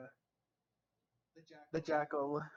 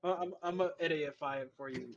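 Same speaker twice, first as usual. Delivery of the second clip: ordinary delivery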